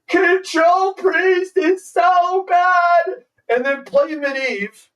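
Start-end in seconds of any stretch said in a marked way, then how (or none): 0.1-3.2 higher, slow, whiny mock complaint; mock whiny crybaby. Mocking the Control Priest opponent, hammed-up whiny wail. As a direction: ham up a sore loser crying over his deck. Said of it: "Be like".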